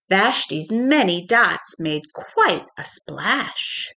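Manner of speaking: plain reading voice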